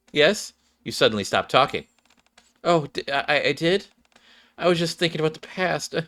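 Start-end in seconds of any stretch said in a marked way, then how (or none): 2.6-6.1 put-on a slightly higher, soft, startled shy girl; lightly flustered shy girl; flustered Clay. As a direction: answer quietly as if caught daydreaming. Clay caught off guard, quiet.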